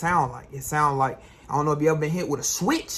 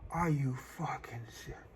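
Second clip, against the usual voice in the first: mock dread; 4 dB louder, much slower than usual. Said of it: "I'm like".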